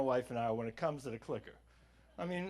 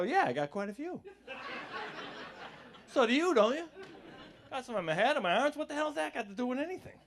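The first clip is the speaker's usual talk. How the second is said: higher, sarcastic comeback; mock snappy retort; 8 semitones higher than usual. Comic snapping back at the rude guy, sarcastic.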